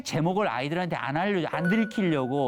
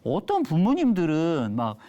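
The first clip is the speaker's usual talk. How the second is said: ordinary talk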